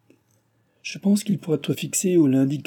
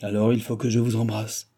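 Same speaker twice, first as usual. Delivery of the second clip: plain reading voice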